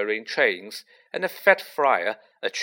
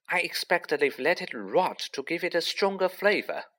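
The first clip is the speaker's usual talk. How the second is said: put-on higher-pitched know-it-all girl voice; knowledgeable; 6 semitones higher than usual. A narrator's reading. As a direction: explain it like an expert, pitched up. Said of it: "said Hermione knowledgeably".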